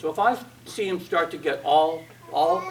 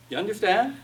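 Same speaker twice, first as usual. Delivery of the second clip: normal talking voice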